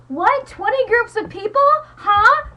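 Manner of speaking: high, loud baffled squawk; incredulous classmates. Retold or imitated. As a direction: play the clueless kids, shocked. Classmates confused, quoted incredulous.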